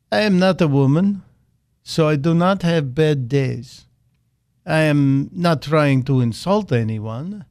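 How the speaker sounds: hammed-up Putin imitation in a Russian accent; bad Russian accent. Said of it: "I'm gonna use my best Vladimir Putin bad accent".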